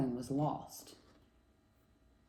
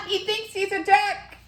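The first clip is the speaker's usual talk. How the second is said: high, laughing story voice; laughing, teasing kids'-story squeal; laughing animal character; 7 dB louder than usual. Acted. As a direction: crow it in high-pitched amusement. Laughing animal jeers in read story.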